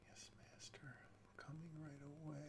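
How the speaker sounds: mock servant, whispered; servile whisper. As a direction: hush out an obedient reply. Mock servant reply to boss.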